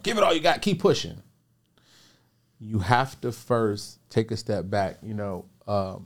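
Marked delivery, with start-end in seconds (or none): 0.0-1.2 high, loud mock pep talk; motivational hype. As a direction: imitate a motivational speaker urging people on. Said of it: "most people be motivational".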